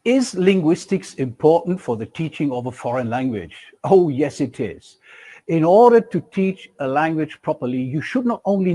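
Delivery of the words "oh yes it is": ordinary delivery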